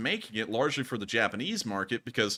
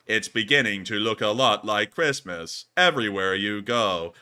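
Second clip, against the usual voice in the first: mock text-bot readout, louder; 3 semitones lower, 4 dB louder than usual. Co-host mimicking how the message was read, text-bot voice. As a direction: recite it loudly like a text bot. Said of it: "you read it off like a text bot".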